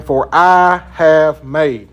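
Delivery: slow, I weighted and falling; slow, leaning on I, God as the doer; stress on I. Shown as a demonstration. Teacher counting God's promises, slow with I pressed. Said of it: "how many times God says".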